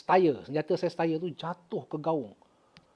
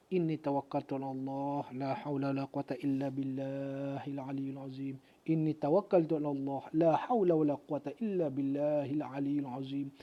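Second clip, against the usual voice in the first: soft Arabic zikir, devout; hushed recitation; 3 semitones lower, 7 dB quieter than usual. Retold or imitated. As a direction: recite it low and earnest.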